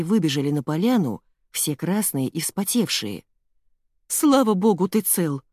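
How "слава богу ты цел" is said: mother exclaiming, higher and louder at first; relieved exclamation. A narrator's reading. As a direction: burst out with relief. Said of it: "воскликнула мама". (облегчённое восклицание)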